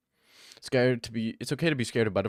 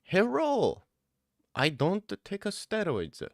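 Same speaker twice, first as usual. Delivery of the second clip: exaggerated, drawn-out Japanese-style English; mock Chinese-Japanese accent; 9 semitones higher, 3 dB quieter, slower than usual. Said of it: "It's more Japanese".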